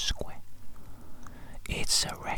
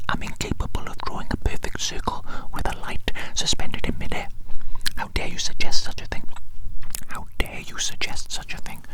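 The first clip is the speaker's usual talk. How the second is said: hammed-up offended whisper, louder; mock indignation; 8 dB louder than usual. Scolding the cheeky listener in mock outrage. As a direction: pretend outrage, teasing not angry.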